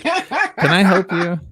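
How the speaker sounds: high, slow, laughing; startled at an onlooker; mock surprised question. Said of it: "I'm like".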